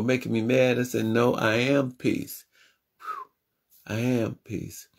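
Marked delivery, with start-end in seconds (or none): 3.0-4.0 long calming exhale. Retold or imitated. Calming breath between affirmations. 4.0-4.9 a hushed echo of I am peace; softer calm repeat. Retold or imitated. Calming himself amid anger.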